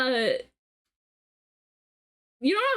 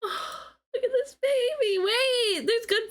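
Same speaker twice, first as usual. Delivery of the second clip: excited squeal; 10 semitones higher, slower than usual. Real, not acted. Squealing over cute plushie.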